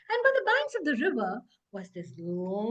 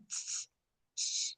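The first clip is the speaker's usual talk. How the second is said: shushing; 8 dB quieter than usual. Acted. Bird hushes from tree.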